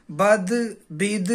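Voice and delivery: بد، بد stretched slowly, sound by sound; slow syllable drill. Shown as a demonstration. Teacher sounding out بد slowly for beginners. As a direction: draw out بد، بد for the class. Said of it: "لہذا اسے پڑھیں گے".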